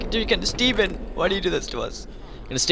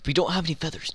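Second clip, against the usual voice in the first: normal talking voice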